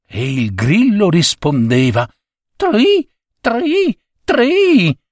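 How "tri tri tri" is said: very high chirping tri tri tri; cricket trill. Acted. Cricket answering the husband with a high trill.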